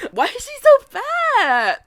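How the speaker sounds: high, loud, slow drawn-out imitation; exaggerated nosy relative, stretched out high; mock rude questioning. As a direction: mimic a tactless, loud question.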